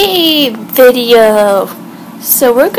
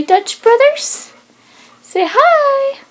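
much higher, coaxing; 12 semitones higher, slower than usual. Real, not acted. Owner urging her dog to greet Dutch Brothers, high baby talk.

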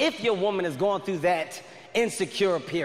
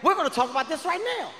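higher, fast and insistent; heated refusal; 8 semitones higher than usual. Retold or imitated. Boyfriend insisting on talking now, heated and high. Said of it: "I said".

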